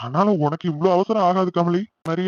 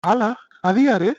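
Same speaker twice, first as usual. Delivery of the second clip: surprised; 5 semitones higher, 5 dB louder, much slower than usual. Acted. Boyfriend puzzled by accusation.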